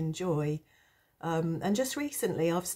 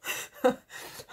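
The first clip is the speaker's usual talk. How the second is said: breathy burst of giggles; short real giggle; embarrassed laugh. Real, not acted. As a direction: laugh helplessly at yourself. Embarrassed laugh after a burp.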